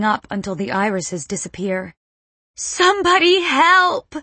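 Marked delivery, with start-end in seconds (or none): 2.6-4.1 high, loud scream for help; panicked, full-out cry for help; straining scream. A narrator's reading. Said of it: "The scream strains my lungs".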